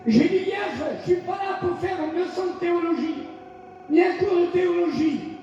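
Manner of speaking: fervent, rising sermon cry; shouted declarations of faith; full-force preaching. Acted. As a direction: proclaim each line to the congregation.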